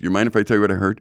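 ordinary talk, nothing marked

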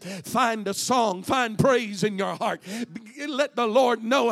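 loud, driving preacher's cadence, pushing toward a shout; a Sunday preacher's roar, calling the church to praise; full-force preaching. Acted. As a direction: preach it hard to the congregation.

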